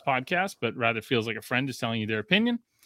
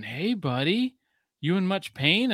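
higher, questioning doctor voice; imitated doctor; 7 semitones higher, slower than usual. Doctors come in to check his busted face, imitated doctor. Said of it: "the doctors were coming in and they're going".

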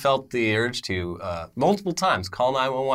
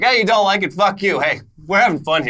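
brash, taunting, louder; defiant mock taunt; 7 semitones higher, 5 dB louder than usual. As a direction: throw it in their faces. Defiant town voiced.

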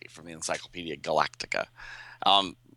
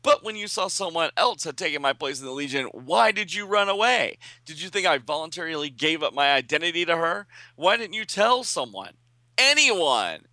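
put-on much higher, exasperated young woman; scolding heroine; 9 semitones higher than usual. Violet scolds Duplicate Boy for running away, high and exasperated. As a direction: tear into him, hurt and cross.

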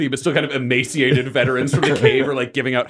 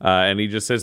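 normal talking voice

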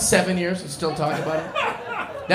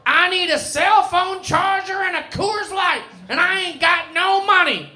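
drunk lady impression; 13 semitones higher than usual. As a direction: pitch way up and drag it like a tipsy stranger. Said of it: "She goes".

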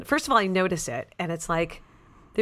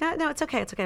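dismissive secretary; 9 semitones higher, 3 dB quieter, faster than usual. Retold or imitated.

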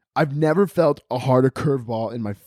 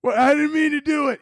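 panicked plea. Acted. Caught emotion pleading with mobster, panicked wail. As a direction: plead in a hammed-up high wail.

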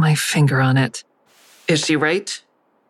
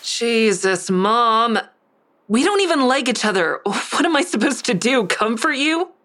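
rising higher and higher in disbelief; exasperated outburst; 7 semitones higher than usual. Acted. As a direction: pitch climbs with bitter frustration. Daughter lashes out at dying mother.

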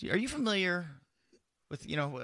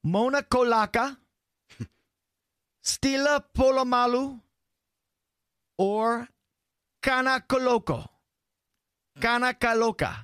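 hammed-up quiz reading; 9 semitones higher, 5 dB louder than usual.

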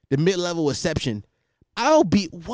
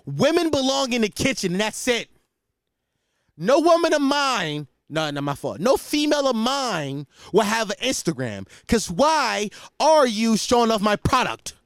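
loud, high haranguing agitator; slow, booming alpha-male sermon; Andrew Tate impression; 9 semitones higher, 4 dB louder than usual. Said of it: "that's just my Andrew Tate impression".

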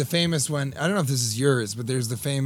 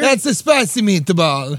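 loud, slower, hammy Italian accent; mock Italian accent; 4 semitones higher, 5 dB louder, slower than usual. Shown as a demonstration. Urging the co-host to do an accent, mock Italian. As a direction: throw out a big cartoon Italian catchphrase. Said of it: "You can do an accent".

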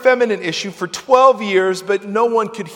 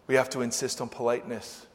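normal talking voice

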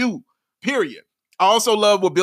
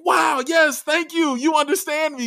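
thrilled fan voice; 9 semitones higher than usual. Retold or imitated. Fan cheering that the team finally listens, high excited call. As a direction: cry out like finally someone listens. Fans finally being heard.

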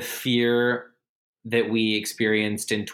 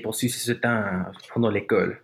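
sexy French; 2 semitones lower than usual. Pretend. Actor faking French fluency for the host, mock-sexy. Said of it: "that was really sexy".

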